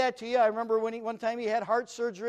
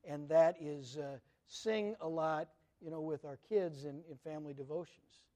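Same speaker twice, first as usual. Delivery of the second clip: ordinary delivery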